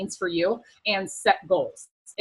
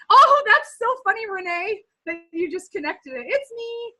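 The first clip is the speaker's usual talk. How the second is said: delighted; 10 semitones higher, 3 dB louder than usual. Real, not acted. Delighted by a viewer's comment.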